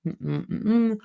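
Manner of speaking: soft closed-mouth humming; tongue circling hum. Shown as a demonstration. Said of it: "I'll show you what I mean".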